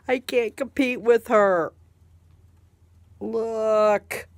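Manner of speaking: excited outburst over an amazing gift; excited gushing. Real, not acted. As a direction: gush loudly in mock defeat, pitch up. Gushing over gifted bird stamps.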